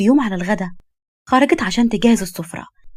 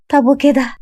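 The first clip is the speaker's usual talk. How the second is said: higher, perky question; hopeful young woman; 5 semitones higher, 4 dB louder, slower than usual. Acted. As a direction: seek his approval. Young woman asks his approval.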